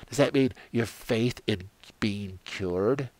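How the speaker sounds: ordinary talk, nothing marked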